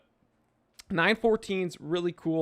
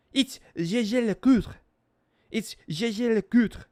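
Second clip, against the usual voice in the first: put-on higher, louder, insistent French accent; French snob correction; 6 semitones higher, 5 dB louder than usual. French snob correcting someone's pronunciation, insistent French accent. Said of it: "French snobs will be like".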